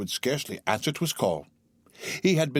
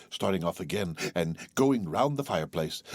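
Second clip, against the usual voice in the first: usual speaking voice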